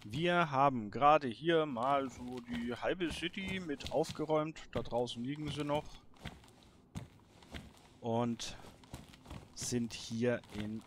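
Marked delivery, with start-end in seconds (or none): none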